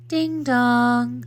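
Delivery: high sing-song ding dong; doorbell chime. A narrator's reading. Doorbell rings in the story.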